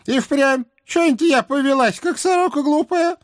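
put-on high nagging old hag; old witch voice. A narrator's reading. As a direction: scold like a fussy old witch. Baba Yaga voiced by reader.

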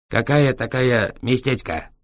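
put-on slow broken Chinese-accented question. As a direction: ask slowly in a Chinese accent. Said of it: "с китайским акцентом". (с китайским акцентом)

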